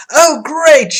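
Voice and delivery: loud, high exclamation; crying out. Acted. Customer whose hair changed colors, crying out. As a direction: cry out in dismay. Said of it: "you cry".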